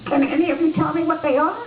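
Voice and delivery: little red hen. Acted. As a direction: play the eager little hen in a high squeak. Hen asks neighbors.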